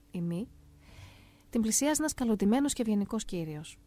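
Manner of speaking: normal talking voice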